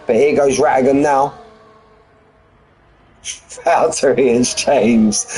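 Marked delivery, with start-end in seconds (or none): 3.3-5.3 laughing. Real, not acted. Laughing at a race moment.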